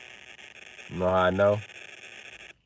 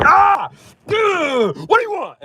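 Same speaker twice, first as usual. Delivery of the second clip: very high, loud exasperated yell; hammed-up enraged dad screaming; mock rage; 8 dB louder, slower than usual. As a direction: explode in comic frustration. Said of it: "It enrages me".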